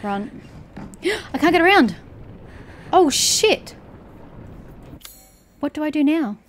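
cornered and panicking; panicked. Real, not acted. Cornered by monster.

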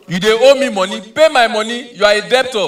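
high, loud creditor's demand; mock angry demand. Acted creditor to debtor over money, loud angry demand. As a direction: hammer out each accusation.

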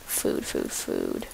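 breathy whisper, calling softly for food. Real, not acted. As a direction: whisper it like coaxing an animal.

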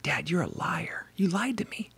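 put-on hushed, whispery accusation of a hurt little girl; softly acted tearful whimper; mock tearful child. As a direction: imitate a child accusing through tears. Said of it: "in tears".

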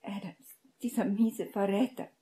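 bitter, spat-out insult; furious. A narrator's reading. Hermine curses the traitor Adams, furious. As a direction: vent the anger at the traitor. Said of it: "brachte sie wuterfüllt über die Lippen".

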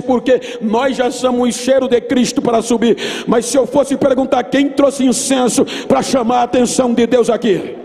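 ordinary talk

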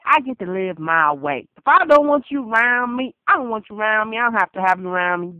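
ordinary talk

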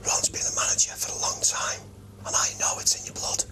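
hoarse, voiceless rasp of a whisper; lost voice, barely above a whisper; knackered voice. Real, not acted. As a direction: talk on with your voice gone. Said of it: "his voice".

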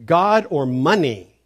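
slow, loud "God or money", both nouns punched; emphatically clear. Retold or imitated. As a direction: drive home "God" and "money" slowly and forcefully. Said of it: "emphatically clear".

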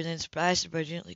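ordinary delivery

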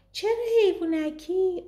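put-on high questioning little girl; child voice. A narrator's reading. Twin asking her sister why, in a high child's voice. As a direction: ask it like a curious kid.

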